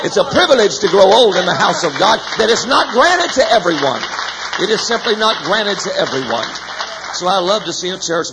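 loud, high preacher's cry; preaching at full force. Acted. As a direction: hammer the refrain home to the congregation. Preaching climax, repeated refrain.

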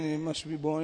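low, soft imitation of mumbling. Untrained interpreter repeating the preacher's words, low mumbling. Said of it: "And he says, mumbling".

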